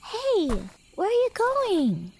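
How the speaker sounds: a sudden high-pitched "hey, wait"; high call after someone. Acted. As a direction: call out as they walk away. Calling after departing traveler.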